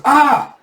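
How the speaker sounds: short high scream; loud, high-pitched cry of alarm; acted scream. Villager heard from afar, short high scream.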